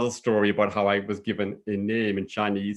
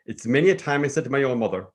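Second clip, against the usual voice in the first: ordinary delivery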